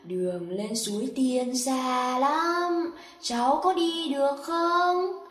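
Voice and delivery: soft, hushed fairy godmother; gentle fairy. Acted. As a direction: speak kindly, like a magical being. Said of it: "Bà tiên nói với Tích Chu". (giọng bà tiên dịu dàng nhẹ nhàng)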